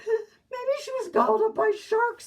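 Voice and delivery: high, loud, crying wail; a child's crying wail; wailing kid. Acted. Kid wailing a wild guess.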